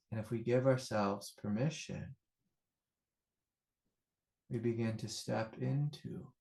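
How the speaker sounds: soft, measured voice with long pauses; meditative teaching. Acted. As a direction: guide listeners inward, quietly and unhurried.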